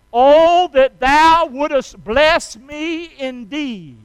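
loud, high cry of prayer, drawn out slowly; fervent biblical prayer. Acted. Preacher voicing Jabez's opening prayer to his church, fervent pleading. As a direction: cry out to God in slow, swelling petition. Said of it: "He starts off his prayer like that".

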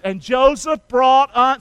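slow, emphatic lead for the congregation; preacher prompting a crowd to join; say-along. Shown as a demonstration. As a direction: draw it out loudly for repeating. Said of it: "say that with me".

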